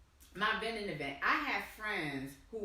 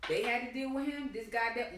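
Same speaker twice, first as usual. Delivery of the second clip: imitating a smug, higher-pitched explanation; mock self-satisfied voice, pitched up; 5 semitones higher than usual. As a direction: act out someone smugly laying down how it works. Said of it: "He was so smug and saying".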